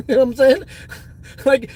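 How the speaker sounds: chuckling fast through the words; laughing. Real, not acted. Streamer laughing at his own joke to chat, giggling.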